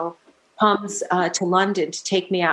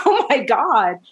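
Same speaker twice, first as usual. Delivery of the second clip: higher, laughing mock amazement; amazed reaction; 5 semitones higher, 4 dB louder than usual. Singer retelling first hearing it, amazed. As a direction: replay your amazement with a laugh behind it.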